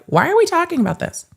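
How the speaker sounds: high, fast whiny question; mock commenter. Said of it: "in the comment section that are gonna be like".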